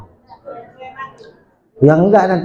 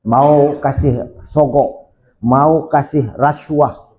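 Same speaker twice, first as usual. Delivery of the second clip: slow emphasis; much slower than usual. Shown as a demonstration. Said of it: "slow emphatic teaching of the word".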